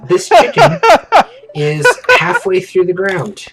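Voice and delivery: words pushed out through laughter; giggling through the words about the chicken; laughing. Real, not acted. Laughing while telling funny part.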